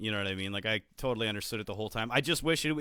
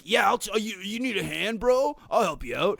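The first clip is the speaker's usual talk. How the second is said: higher, louder eager bro voice; a higher mock eager bro volunteering; overeager helper; 7 semitones higher, 4 dB louder than usual. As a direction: oversell the offer to help like a try-hard. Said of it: "the guy that's like".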